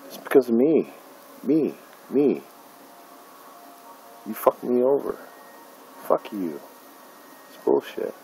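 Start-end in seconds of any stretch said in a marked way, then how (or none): none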